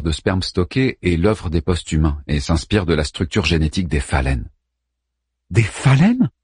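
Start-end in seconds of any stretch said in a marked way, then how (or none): 5.5-6.3 high, loud disbelieving question; incredulous. Acted. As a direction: throw the word back, stunned. Ada shocked by absurd claim.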